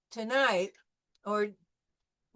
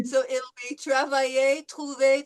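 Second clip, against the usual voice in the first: laughing; 2 semitones higher, 7 dB louder than usual. Real, not acted. Laughing while reading words.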